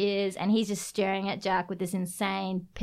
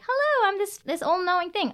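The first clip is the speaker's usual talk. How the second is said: very high, chirpy greeting; mock high-pitched hello; unhinged all-knowing creature; 10 semitones higher than usual. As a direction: imitate an all-knowing little being. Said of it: "his emotions are unhinged".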